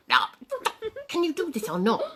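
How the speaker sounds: normal talking voice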